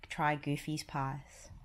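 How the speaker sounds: ordinary delivery, nothing marked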